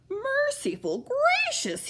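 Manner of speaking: put-on high, slow, startled old bell ringer; gulping in alarm. A narrator's reading. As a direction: gulp it out in shock, up high. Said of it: "he gulped".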